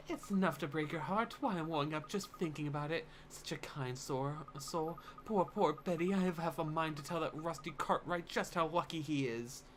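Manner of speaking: put-on sympathetic old lady getting worked up; doting old neighbour, upset. Old neighbour pitying the wife, fretful and sympathetic. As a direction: fret over a friend as a gossipy old woman. Voices the neighbour in game dialogue.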